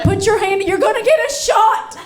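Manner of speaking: high, hurried mock alarm; mock soldiers warning. Soldiers warning the comic, high mock-urgent alarm. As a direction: imitate soldiers yelling stop. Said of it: "They were like".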